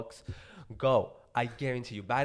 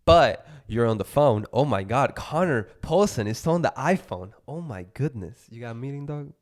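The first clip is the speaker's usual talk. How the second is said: mock amazed teasing; 10 dB louder than usual. Teasing friend taking a call.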